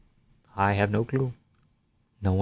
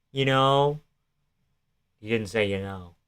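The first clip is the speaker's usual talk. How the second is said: normal talking voice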